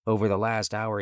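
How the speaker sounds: ordinary delivery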